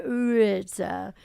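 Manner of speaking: put-on "Rita" drawn out like a little boy; slow, childish "Rrrita" for Peta; child's mispronunciation. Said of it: "he couldn't say Peta, so he would say".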